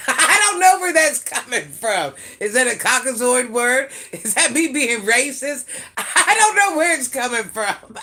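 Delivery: high, loud, cracking up while talking; laughing hard. Real, not acted. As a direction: shout it through real laughter, helpless at your own slip. Cracks up over saying Russian.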